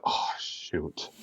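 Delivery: soft whispered dismay; whispered oh shoot. Retold or imitated. Caught out by Frank, a whispered dismayed aside. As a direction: breathe it out, caught. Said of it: "And I'm like".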